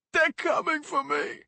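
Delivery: high, slow, terrified cry; paranoid wail. Acted. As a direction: wail it in fear. Paranoid sobbing kid.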